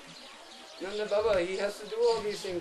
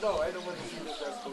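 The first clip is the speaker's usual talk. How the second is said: put-on sulking child. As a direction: pout and refuse to look at her.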